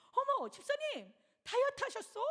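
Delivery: high, loud surprised gush at a friend's weight loss; mock astonished high voice; surprised exclamation. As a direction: gasp out the compliment in surprise. Pastor retells her own surprised remark.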